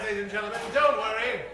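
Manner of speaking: ordinary delivery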